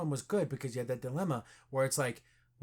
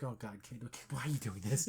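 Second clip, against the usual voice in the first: much lower, softer weary sigh; exasperated mutter; 6 semitones lower, 9 dB quieter than usual. Retold or imitated. Friend voicing Doctor Strange, low soft exasperation. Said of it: "Doctor Strange's like".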